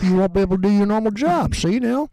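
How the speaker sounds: high, put-on scolding father voice; hammed-up high-pitched dad telling off his son; mock scolding dad. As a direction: lecture him like an exasperated old dad.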